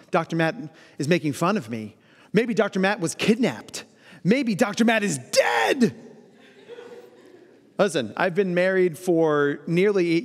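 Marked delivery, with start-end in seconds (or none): 0.1-6.0 spiral into alarm, ending in a slowed shout on dead; mounting mock panic. Anxious partner imagining the worst, rising to a panicked shout. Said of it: "Maybe your brain is going".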